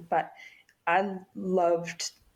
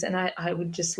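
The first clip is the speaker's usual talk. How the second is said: ordinary talk, nothing marked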